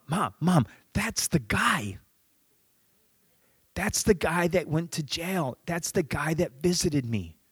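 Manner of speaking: put-on high, hushed, urgent boy; excited stage whisper. Teenage boy points out the visitor to his mom, excited whisper. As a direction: whisper it high, tugging at mom's attention.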